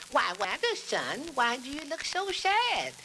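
high, slow motherly figure voice; mama voice. Acted. Mama asking her sad son, mother voice. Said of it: "His mama sitting there peeling potatoes. She says".

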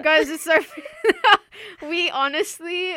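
talking through giggles; breaking up with laughter; laughing. Real, not acted. Hosts can't stop laughing.

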